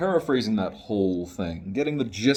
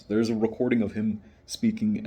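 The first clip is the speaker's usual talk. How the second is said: ordinary talk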